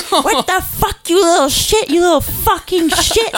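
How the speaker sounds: high, loud mock rage; hammed-up furious father yelling; imitating furious dad. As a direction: yell it like the enraged dad shaking his son. Dad shaking brother, quoted rage.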